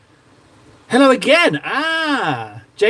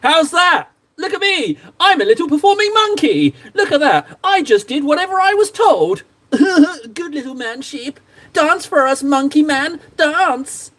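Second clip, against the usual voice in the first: hammed-up mockery, pitched high; mock performing monkey; 7 semitones higher than usual. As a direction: jeer at yourself in a high voice. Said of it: "singing like a little dancing monkey".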